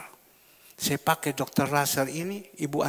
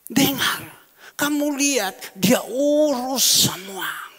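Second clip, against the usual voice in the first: urging emphatically; 7 semitones higher, 4 dB louder, slower than usual. Retold or imitated. Insisting to son-in-law.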